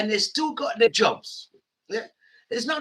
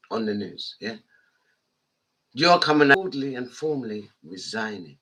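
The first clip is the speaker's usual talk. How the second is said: usual speaking voice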